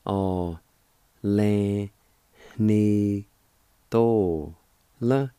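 "A, Lan, ni, to, la" with a pause after each; syllable by syllable. Shown as a demonstration. Teacher sounding out each syllable for students. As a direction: separate each syllable clearly. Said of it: "It's pronounced like this".

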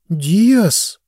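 put-on girl's high surprised echo; surprised question. A narrator's reading. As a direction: ask back in surprise. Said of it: "удивлённо переспросила Лилани". (удивлённо переспрашивая)

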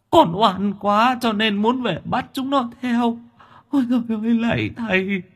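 a frightened spirit pleads, mildly whimpering; whimpering ghost. Acted. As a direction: beg the exorcist, whimpering and rising as the fear builds. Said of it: "sợ mất mật mếu máo".

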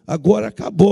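usual speaking voice

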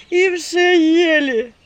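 high, loud, very slow wail; loud peasant keening; continuing lament. A narrator's reading. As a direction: drag out the lament. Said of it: "продолжал причитание голос за дверью".

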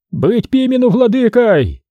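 high shout for Pimen. A narrator's reading. Dorofei backs Pimen in the brawl, high shout. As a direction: shout it over the crowd. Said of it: "кричал Дорофей".

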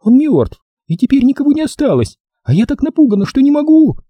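frightened woman, very high voice; frantic female hysteria; hysterical. Acted. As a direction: plead in high-pitched panic. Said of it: "Голос молил на истерической ноте".

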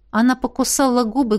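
usual speaking voice

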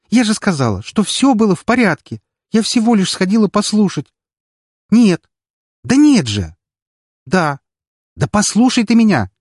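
high, loud upset phone argument; upset arguing. A narrator's reading. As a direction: plead and argue with grandpa. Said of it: "продолжала причитать".

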